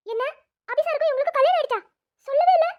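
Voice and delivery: high, loud astonishment; shocked disbelief. Acted. Friend learns the doctor is secretly married, shocked. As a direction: blurt it out, stunned.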